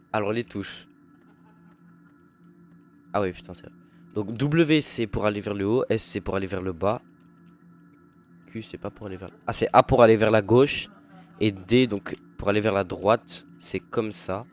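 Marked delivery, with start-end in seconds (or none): none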